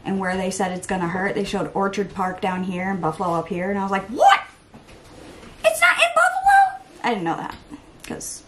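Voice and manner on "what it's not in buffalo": very high, loud squawk of disbelief; mock astonishment. Vlogger reenacting seeing the weather map, astonished. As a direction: shriek it in exaggerated shock. Acts out her surprise at map.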